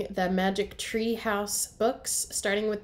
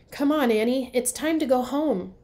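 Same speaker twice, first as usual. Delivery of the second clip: louder, higher call across the woods; yelling; 4 semitones higher, 6 dB louder than usual. Acted. Jack calling his sister home at sunset, a yell. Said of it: "yelled Jack".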